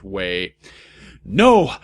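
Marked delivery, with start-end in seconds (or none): 1.2-1.8 high, loud scream. A narrator's reading. Heroine seeing the vision, loud scream. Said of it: "I screamed sexily".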